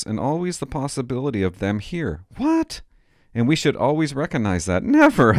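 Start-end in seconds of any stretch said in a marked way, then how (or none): 2.4-2.8 sharp high disbelieving what; incredulous what. Real, not acted. Comic reading his kid's school paper, incredulous yelp. 4.8-5.3 loud high refusal; defiant shout. Real, not acted. Refusing what the paper says, loud defiant shout.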